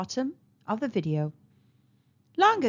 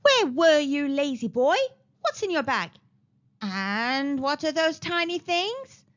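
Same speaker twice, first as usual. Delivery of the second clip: raised granny voice, drawing out the last question; a touch of a yelled interrogation; mild scolding yell; 4 semitones higher, 3 dB louder than usual. A narrator's reading. Granny questioning the boy about his bag, mild yell. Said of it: "Missus Elsa yelled at Chiku".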